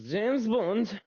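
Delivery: high mock Spanish-accented call; imitating Spanish accent. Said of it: "Spanish guy that speaks very little English".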